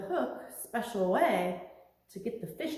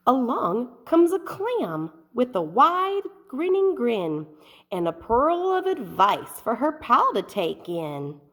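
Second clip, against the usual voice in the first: lightly voiced, upbeat clam coaxing a friend; a hint of a chirpy clam; cheery clam; 4 semitones higher, 8 dB louder than usual. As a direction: nudge the sad fish to smile. Clam's cheerful advice.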